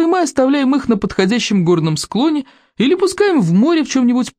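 high, swooping, theatrical scorn; amused chief condescendingly explaining; mocking berserker chief. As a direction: relish each insult with exaggerated lilt.

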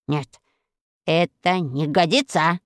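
a hint of a plotting giantess; scheming witch musing. A narrator's reading. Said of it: "приказала сама себе Арахна".

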